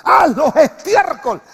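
slow, loud and high, proclaiming "Haz los estiércol"; preacher's shouted correction; corrected quote, shouted. Shown as a demonstration. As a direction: boom out the corrected verse. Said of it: "No, Pablo, David dice".

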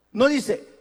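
ordinary talk, nothing marked

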